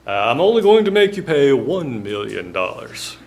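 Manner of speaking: mock-indignant Southern judge; Mississippi judge accent. The judge scoffs at the jury award in a Mississippi accent. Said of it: "sounds just like the lawyer".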